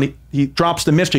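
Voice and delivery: ordinary talk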